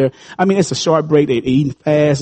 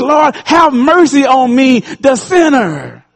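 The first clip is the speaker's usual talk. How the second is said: high, slow, pleading cry for mercy; lightly voiced repentant sinner; humble sinner's plea; 7 semitones higher, much slower than usual. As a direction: beg for mercy, stretching it out. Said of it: "smote his breast and said".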